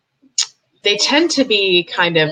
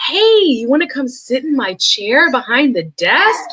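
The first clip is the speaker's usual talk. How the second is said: put-on high, slow voice for a little kid; talking down; 6 semitones higher, slower than usual. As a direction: coo at him like a child. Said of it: "all of the judges were very".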